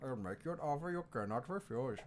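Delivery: put-on Indian-accented mob offer; Indian Godfather. Indian Godfather making an offer, Indian accent. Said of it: "In an alternate universe, Godfather".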